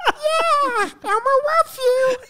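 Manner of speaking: very high squeaky puppet falsetto; Elmo. Acted. Greeting kids with a puppet, high giggly Elmo voice. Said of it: "you know this Elmo".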